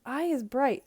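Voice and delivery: mild excited shout. A narrator's reading. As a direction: call out the clue loudly. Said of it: "I shouted".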